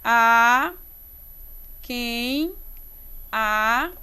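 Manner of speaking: isolated syllables with long pauses; measured scansion, one piece at a time. Shown as a demonstration. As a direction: separate each syllable for counting. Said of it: "Como é que eu separo?".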